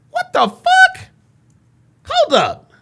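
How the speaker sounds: a high, incredulous what the fuck; stunned disbelief. Real, not acted. Streamer baffled by the girl begging for jail, exclaiming in disbelief. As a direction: react in total disbelief. Streamer reacts to the girl's plea.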